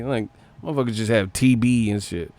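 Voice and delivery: usual speaking voice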